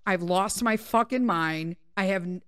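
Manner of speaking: ordinary delivery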